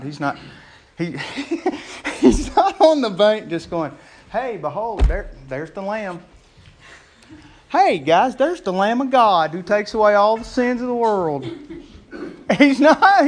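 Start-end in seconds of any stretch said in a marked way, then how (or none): 4.3-11.5 casual, offhand announcement, not much weight; breezy, half-interested call to the guys; flippant. Retold or imitated. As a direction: toss it off lightly like pointing out a bus. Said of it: "not flippantly just saying that".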